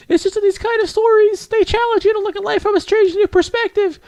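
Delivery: very high falsetto, earnest ramble; squeaky-high, sliding into sheepish apology; rambling bookish girl. Acted. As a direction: get carried away as a shy girl in falsetto. Voices Yuri rambling.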